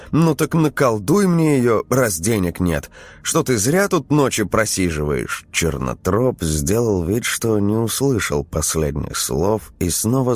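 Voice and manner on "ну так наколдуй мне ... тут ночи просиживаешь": scolding wife. A narrator's reading.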